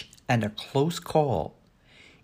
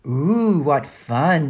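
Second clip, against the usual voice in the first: delighted; 3 semitones higher, 9 dB louder, much slower than usual. A narrator's reading. Danny hears pizza is for lunch, delighted. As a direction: burst out with delight.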